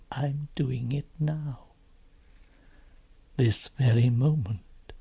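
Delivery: slow, low faun voice with long pauses; grave confession. Acted. Faun revealing his betrayal to Lucy, slow and grave. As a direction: spell it out heavily, bit by bit.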